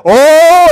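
high, booming group-gasp imitation; loud "oh!" of alarm. Preacher imitating his family hearing the crash, loud alarmed "oh". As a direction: cry out like a startled household.

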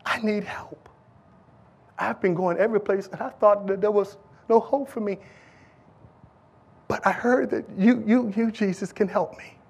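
soft pleading near-whisper; hushed plea. Acted. Woman begging Jesus for help, soft hushed plea. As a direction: beg for help barely above a breath.

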